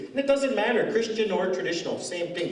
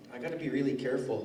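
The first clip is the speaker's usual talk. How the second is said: normal talking voice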